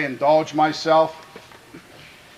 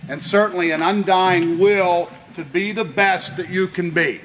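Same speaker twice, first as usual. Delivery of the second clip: rousing speech; 4 semitones higher than usual. Acted. Coach rallying his players before kickoff, building to a rousing pitch. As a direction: pitch climbing, pushing the words to fire them up.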